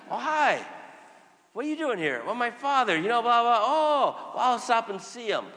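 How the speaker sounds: high, bright mock chat; lightly voiced acquaintance. A hint of a friendly parishioner chatting in the hallway. Retold hospital chat, other voice.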